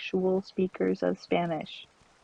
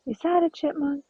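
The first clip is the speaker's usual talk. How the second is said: surprised question; 7 semitones higher, 5 dB louder than usual. Real, not acted. Spots a squirrel in game.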